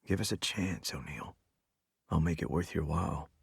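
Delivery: hushed, intimate plea; whisper. A narrator's reading. Assistant coaxing his boss after a kiss, whispering. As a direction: drop to a very soft near-whisper. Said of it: "I whisper".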